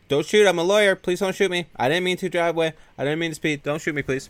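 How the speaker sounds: high-pitched mock begging; panicked pleading. Lawyer with hands up begs the cop, mock panic.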